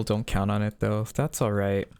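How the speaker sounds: ordinary talk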